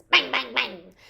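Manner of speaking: short percussive bangs; imitated gunshots. A narrator's reading. Hunters fire their guns in the forest, punchy bangs. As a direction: fire off the words like shots.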